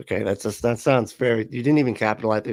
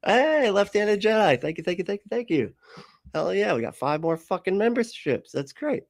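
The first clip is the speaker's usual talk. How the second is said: high-pitched, delighted streamer shout-out; real excitement, higher voice; excited thanks; 8 semitones higher than usual. Real, not acted. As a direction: thank a generous viewer, pitch lifted. Thanking a viewer for gifted memberships.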